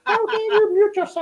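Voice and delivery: very high-pitched pretend indignation; mock outrage. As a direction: scold in an exaggerated high voice, all in jest. Mock scolding of muted co-host.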